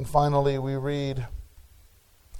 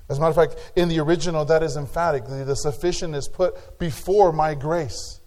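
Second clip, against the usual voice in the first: usual speaking voice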